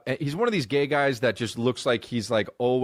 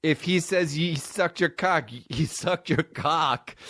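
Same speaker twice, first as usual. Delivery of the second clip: slow, drawn-out backing-up of her friend; imitated woman sidekick; 5 semitones higher, slower than usual. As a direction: stretch each word, mimicking his companion. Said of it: "The chick was standing there like".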